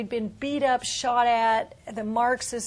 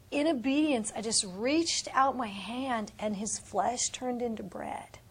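ordinary talk, nothing marked

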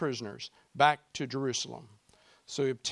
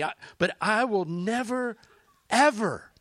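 emphatic; 8 semitones higher, 6 dB louder than usual. Acted. Climax of conversion story.

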